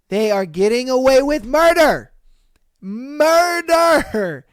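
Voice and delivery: loud, high outraged ranting. Real, not acted. Vlogger ranting to viewers, loud and outraged. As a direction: hammer home the outrage. Ranting about cheating women.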